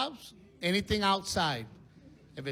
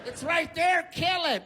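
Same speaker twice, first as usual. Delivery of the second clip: high-pitched urgent wife voice; mock panic; 9 semitones higher, slower than usual. Wife tells husband to kill spider, mock panic. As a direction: order it in a squealed mock fright.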